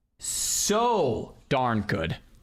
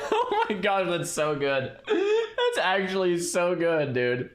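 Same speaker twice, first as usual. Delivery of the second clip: giggling through the words; laughing; 5 semitones higher, slower than usual. Real, not acted. Streamer rewatching the fake death, laughing in delight. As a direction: laugh while praising it.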